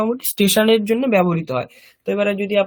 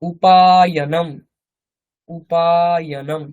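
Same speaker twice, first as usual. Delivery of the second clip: slow modeling; 4 dB louder, slower than usual. Shown as a demonstration. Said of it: "সেটাকে আমরা কি বলবো?".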